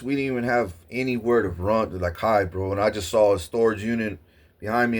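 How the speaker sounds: normal talking voice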